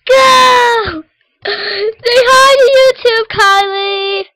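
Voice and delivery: bright yelled greeting, stretched slow; excited shout. Real, not acted. Kid opening her video with an excited yell. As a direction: call it out big to the camera.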